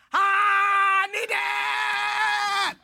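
David crying out to the Lord, anguished wail; desperate cry. Retold or imitated. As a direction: stretch the plea into a loud anguished wail. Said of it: "He actually cried".

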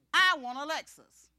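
I want a Lexus, said slowly with full conviction; firm declaration. Acted. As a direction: plant your feet and declare it slowly. Insisting on the Lexus.

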